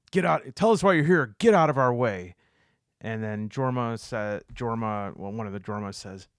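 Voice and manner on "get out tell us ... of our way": loud, raised-pitch demand to clear out; barked order. Acted. Party confronting a stranger in the hallway, barked order. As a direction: shout it as a forceful command.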